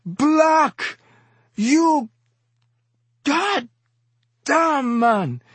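very high, impassioned outcry; emphatic tearful exhorter. A narrator's reading. Exhorter swinging a knife for emphasis, high anguished harangue. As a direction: hammer each phrase with anguished force. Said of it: "he said, swinging the knife for emphasis".

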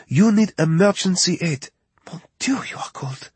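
high French voice dropping to an urgent whisper; alarmed whisper. Acted. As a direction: whisper it in alarm at how cold he is. Justine alarmed over wounded man.

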